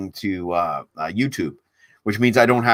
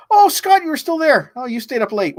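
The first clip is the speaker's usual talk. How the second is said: surprised greeting; 4 dB louder than usual. Real, not acted. Streamer surprised to see Scott still watching, bright high greeting. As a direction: pitch jumps high on a delighted oh, Scott. Streamer spots a late viewer.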